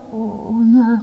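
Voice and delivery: high, hesitant stammer; mock nervous lecturer. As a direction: stumble into an uneasy yes.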